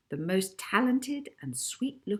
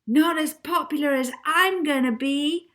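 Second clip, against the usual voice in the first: high, loud defiant shout; Molly shouting at the TV man; 10 semitones higher, 8 dB louder than usual. Acted. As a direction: yell it back at the TV. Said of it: "shouted Molly".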